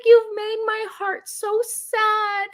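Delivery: put-on high, slow whiny child voice; imitating her toddler. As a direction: sulk it like a little boy. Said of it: "He's like".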